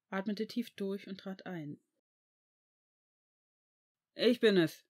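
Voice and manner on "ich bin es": loud call on coming in. A narrator's reading. Severus announces himself on entering his flat, calling out. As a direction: call it out to someone in another room. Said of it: "rief er".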